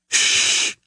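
sharp unvoiced shush; hiss. A narrator's reading. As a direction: hiss for silence through a door. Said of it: "she hissed through the closed door".

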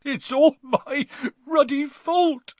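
high, slow, anguished wail; loud, drawn-out tearful self-blame; sobbing. A narrator's reading. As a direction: wail it out through acted sobs. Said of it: "he sobbed".

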